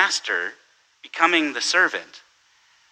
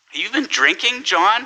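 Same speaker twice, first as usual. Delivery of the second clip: higher incredulous chief; chief's disbelief; 8 semitones higher, 3 dB louder than usual. Retold or imitated. Chief doubting the officer on the radio, incredulous. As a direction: scoff at the officer's report. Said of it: "he says".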